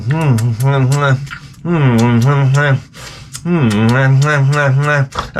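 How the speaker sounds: greedy rhythmic humming while gnawing; mock gnawing noises. Comic acting out eating a pork chop bone, greedy muffled hums. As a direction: hum hungrily into a bone. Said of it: "get into a bone and you're like".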